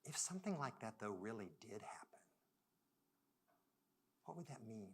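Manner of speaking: hushed, almost whispered wondering; the colleague's tender question; quiet, gentle question. Retold or imitated. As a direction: ask it softly, as if it matters. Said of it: "she said".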